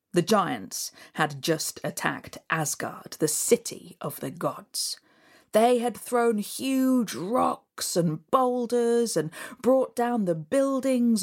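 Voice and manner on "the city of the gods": usual speaking voice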